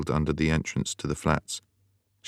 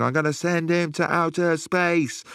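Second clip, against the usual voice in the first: mock rave shout; 13 semitones higher than usual. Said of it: "I got up and I shouted".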